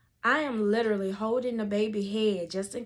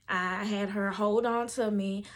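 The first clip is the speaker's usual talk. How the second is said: ordinary talk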